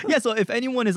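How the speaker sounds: real amused laugh. Real, not acted. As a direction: laugh then start the pitch. Laughing at the joke.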